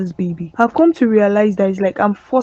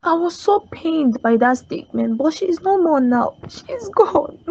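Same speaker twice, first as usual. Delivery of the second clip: crying; 5 semitones higher than usual. Acted. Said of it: "Please stop crying".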